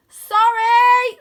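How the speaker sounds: put-on high, slow and loud goofy boy; calling down in a big high cartoon voice; bright playful wizard-boy voice; Wyn's voice. A narrator's reading. Wyn apologises.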